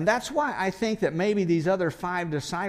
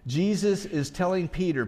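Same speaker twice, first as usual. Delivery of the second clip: usual speaking voice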